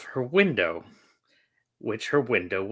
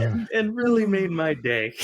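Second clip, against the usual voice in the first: laughing; 10 semitones higher than usual. Real, not acted. Still laughing.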